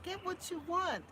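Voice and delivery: high, coaxing daughter's voice; daughter's urging voice. Retold or imitated. Daughter telling mommy to choose, high and coaxing. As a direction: urge mom to pick anything, voiced high. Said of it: "but then she said".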